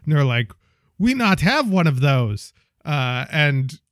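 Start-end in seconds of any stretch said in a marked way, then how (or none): none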